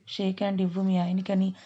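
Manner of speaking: plain reading voice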